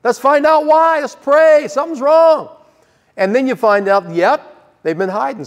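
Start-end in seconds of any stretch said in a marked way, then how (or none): 0.0-2.5 higher, brisk and keen; eager encouragement. Retold or imitated. Pastor answering a troubled person, eager and raised. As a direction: welcome the trouble with eager energy. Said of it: "I say".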